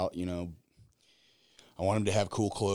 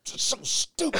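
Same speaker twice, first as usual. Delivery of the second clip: put-on high breathy whispered kid line; mock kid whisper. Four-year-old to his mom, high whispered naughty word. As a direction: hiss it like a forbidden word. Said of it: "he'll be like".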